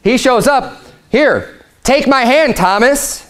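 lifted, eager voice inviting Thomas; Jesus speaking. Retold or imitated. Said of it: "He's saying".

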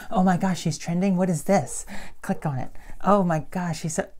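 mock excited viewer; imitating excited viewer. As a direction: gush like a curious viewer.

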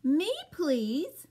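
a small eager cat begging. Acted. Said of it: "says Cat".

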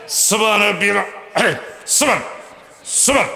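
clipped rushed tasbih, cut off mid-word; mock hasty praying. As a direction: parody a worshipper gabbling through prayer. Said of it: "খুব দ্রুত তসবিহ পড়ে".

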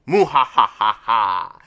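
slow, loud villain cackle; slow evil laugh. Acted. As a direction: drag the laugh out wickedly. Voicing villain Orochi's laugh.

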